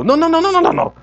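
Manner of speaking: rapid-fire string of loud, high no's; high, loud volley of no's; insistent repeated no. Acted. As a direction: parent hammering no at a child. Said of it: "we don't get it on the one no".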